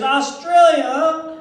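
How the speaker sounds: slow, high, loud rising Texan Australia?; puzzled Texan. Retold or imitated. Texan man baffled by the word Australia, slow mock drawl. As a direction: draw out Australia? as if never heard of it. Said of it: "He goes".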